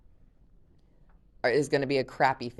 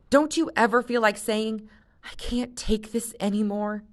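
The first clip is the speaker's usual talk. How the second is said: passionate plea; 6 semitones higher than usual. Acted. Jen venting to Luke.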